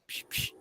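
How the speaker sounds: soft, offhand "psh, psh"; casually mimicked hiss of a spray can; nonchalant spray noise. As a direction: imitate two quiet spray bursts. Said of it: "it was so nonchalant".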